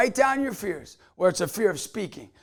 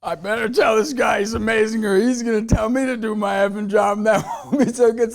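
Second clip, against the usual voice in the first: son's worried voice; 5 dB louder than usual. Retold or imitated. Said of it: "is like".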